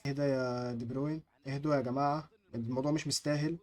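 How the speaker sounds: low, slowed, settling voice; calming down. Real, not acted. Gamer telling his players it's not worth it, calming down. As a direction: talk everyone down, low and soft.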